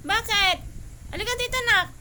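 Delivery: high, loud call to a pet. Real, not acted. Owner calling anak to come here, loud calling. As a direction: call the dog over loudly.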